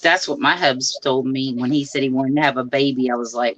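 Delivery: ordinary delivery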